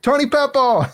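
high excited fan shout of the name; fan calling out. Retold or imitated. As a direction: call out like a fan who spots her. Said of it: "They're like".

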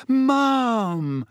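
high, drawn-out theatrical greeting; mock surprise. A narrator's reading. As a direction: feign delighted discovery of her. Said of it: "as though he'd only just spotted her".

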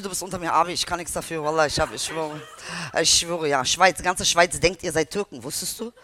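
usual speaking voice

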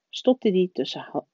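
ordinary delivery, nothing marked

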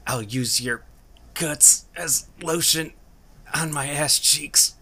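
high, slow menacing threat; drawn-out sneering threat; menacing villain voice. Acted.

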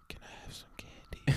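put-on whispered sneaky child request; mock child whisper. Toddler secretly whispering to grandma for candy.

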